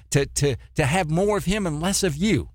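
ordinary talk, nothing marked

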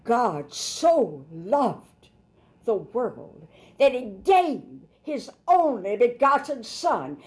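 very slow, drawn out, rising pleading; slow preacher's plea, pitch climbing toward Son; emotional appeal. Shown as a demonstration. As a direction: linger on each word as a heartfelt plea. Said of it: "No, an emotional appeal".

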